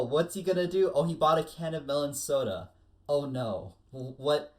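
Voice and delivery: ordinary talk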